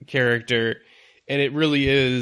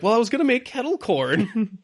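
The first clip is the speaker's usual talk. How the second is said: higher-pitched retold whine; mock protest; 8 semitones higher than usual. Imitating Stan reluctant to leave his chair, mock protest. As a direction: lightly plead it in a higher voice.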